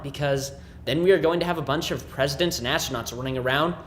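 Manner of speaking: ordinary talk, nothing marked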